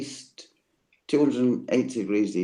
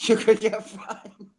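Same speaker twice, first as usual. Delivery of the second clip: laughing; 9 semitones higher, 4 dB louder than usual. Real, not acted. Punchline through laughter.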